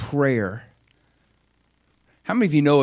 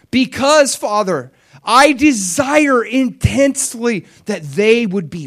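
very loud, high, resolute prayer; shouted vow, pitched high; impassioned cry; 11 semitones higher, 10 dB louder than usual. Acted. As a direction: declare it at full force to the Father. Acting Jesus's intense prayer to Father.

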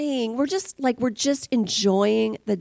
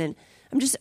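usual speaking voice

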